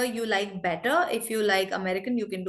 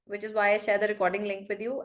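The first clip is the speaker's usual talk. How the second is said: normal talking voice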